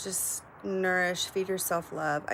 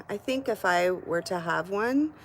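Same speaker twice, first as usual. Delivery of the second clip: ordinary talk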